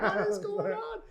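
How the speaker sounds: squealed retold disbelief; mock bewilderment. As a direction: act out baffled panic, half laughing. Reenacting shock, laughing.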